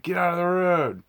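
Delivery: loud, high-pitched yell imitating an irate driver; imitated driver's yell. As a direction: yell it out like the passing driver, drawing it out. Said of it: "Did that guy just yell at you".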